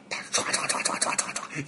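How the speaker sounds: quick breathy swish-swish sound effect; whooshing run. Acted. Deer races past the monkey, fast breathy whoosh. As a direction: rush the swishes out fast and airy.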